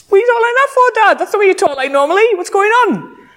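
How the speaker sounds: high-pitched, hammed-up kids questioning dad; incredulous kids. Mimics kids laughing at dad's voice.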